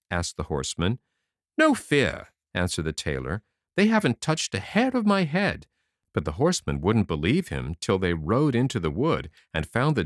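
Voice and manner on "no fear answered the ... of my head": higher, brisk and cocky; boastful little tailor. A narrator's reading. Tailor bragging to the king's messenger, high cocky voice. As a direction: puff yourself up like a tiny hero.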